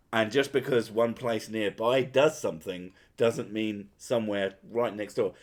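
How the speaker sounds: ordinary delivery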